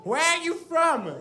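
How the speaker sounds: high, loud yell at someone hard of hearing; mock screaming. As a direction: scream it like a drunk party girl. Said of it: "she starts screaming".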